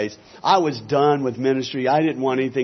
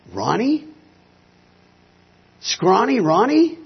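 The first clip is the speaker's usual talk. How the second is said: acted-out questioning call, pitched high; incredulous recognition; 9 semitones higher, 4 dB louder, slower than usual. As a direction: draw the name out high as a disbelieving question. Recognizing an old friend in the dark.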